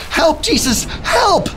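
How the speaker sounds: high, panicky plea; acted cry for help. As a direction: call out high like frightened sailors. Acts frightened friends waking Jesus.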